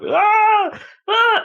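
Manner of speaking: very high mock wail of a hurt kid; loud high-pitched mock cry; imitated kid crying out. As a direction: wail like a bawling child. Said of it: "He was bawling his eyes out".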